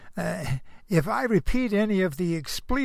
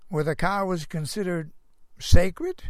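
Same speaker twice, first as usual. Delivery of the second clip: ordinary talk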